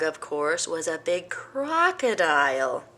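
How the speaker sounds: plain reading voice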